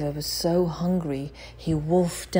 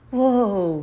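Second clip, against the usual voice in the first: shout; 5 semitones higher, 4 dB louder than usual. A narrator's reading. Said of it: "he shouted".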